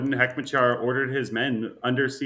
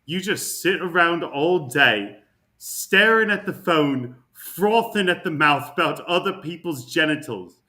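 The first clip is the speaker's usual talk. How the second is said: slow, loud mock Scottish accent; 4 semitones higher, 5 dB louder than usual. Reading a Scottish tweet aloud in mock Scottish accent. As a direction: read it like a Scottish bloke ranting. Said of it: "Scottish tweet".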